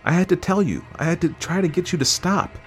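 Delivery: high, urgent wife; earnest, high-pitched appeal; pleading justification. A narrator's reading. Wife pleads her case.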